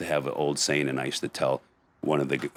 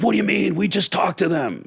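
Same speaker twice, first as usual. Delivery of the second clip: much higher, louder, hammed-up cop protest; mock indignant cops; 5 dB louder than usual. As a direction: play the baffled cops, squeaky and outraged. Normal cops protesting the chief.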